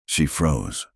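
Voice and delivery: plain reading voice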